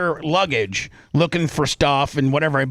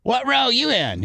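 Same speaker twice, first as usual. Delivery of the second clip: cocky buddy; 9 semitones higher than usual. Retold or imitated. Lasker needling a passenger.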